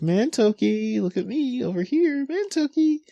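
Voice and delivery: very high singsong calling voice; coaxing a baby. Acted. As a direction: squeal playfully for the baby's attention. Shinpachi coaxing the baby to look.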